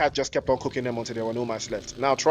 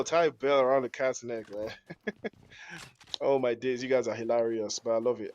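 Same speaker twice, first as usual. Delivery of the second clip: ordinary delivery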